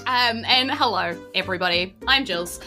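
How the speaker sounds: ordinary talk, nothing marked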